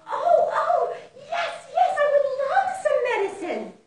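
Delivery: high, loud, fast eager yes; eager excited mouse. Acted. Mouse answering the voice, high fast eager delight. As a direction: grab the offer with delight.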